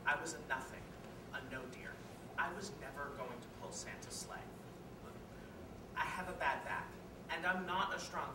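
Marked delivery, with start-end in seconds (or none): 0.1-6.9 low, soft, resigned confession; subdued admission. Acted. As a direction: admit it quietly, low and soft. Monologue, character admits his failings.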